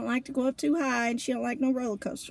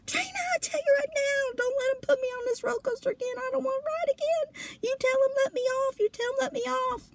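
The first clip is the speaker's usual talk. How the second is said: much higher, near-crying panicked plea; panicked pleading; 13 semitones higher than usual. Retold or imitated. Marie begging Trina to stop the coaster, panicked crying plea. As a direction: beg like you're trapped and terrified. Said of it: "She's like".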